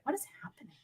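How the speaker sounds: voiceless hush of confusion; whispered. Retold or imitated. Stuck in a gun class, whispering to herself. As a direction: whisper it under your breath. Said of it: "I'm audibly getting louder".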